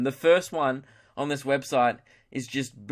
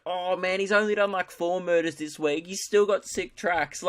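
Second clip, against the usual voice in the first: lightly voiced higher-pitched fan; mock fan making excuses, pitched up; forgiving fan; 5 semitones higher than usual. Fan excusing his idol's murders, lightly imitated.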